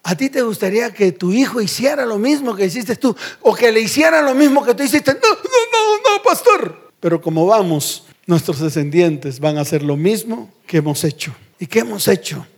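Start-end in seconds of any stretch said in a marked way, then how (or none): 5.1-6.9 high-pitched, exaggerated refusal; mock protesting congregant. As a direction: squeal out a mock objection. Mimics horrified congregant refusing.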